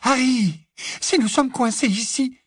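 high, urgent alarm in the voice; a hint of panic rising; horrified. A narrator's reading. Hermione to Harry, fearing they are trapped, alarmed. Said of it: "l'air horrifié".

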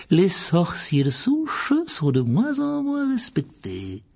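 aiguë, lente et mielleuse; voix doucereuse. Acted. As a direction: susurrer avec une fausse douceur. Barjot flatte Malfoy. (voix doucereuse de marchand flatteur)

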